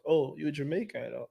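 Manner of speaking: ordinary talk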